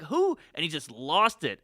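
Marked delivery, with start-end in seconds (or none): 0.0-0.4 baffled who. Retold or imitated. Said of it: "he was like".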